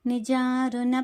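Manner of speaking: slow, syllable by syllable chant of the name; slow devotional name recital; slow recitation. Shown as a demonstration. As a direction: recite each syllable clearly and unhurried. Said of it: "ఈరోజు మనము మాట్లాడుకోబోయే నామము".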